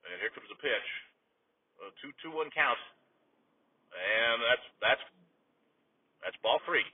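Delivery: low, quiet baseball commentary with long pauses; subdued play-by-play. Acted. Announcer returning to the game after a tirade, subdued commentary. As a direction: drop back into hushed game calling.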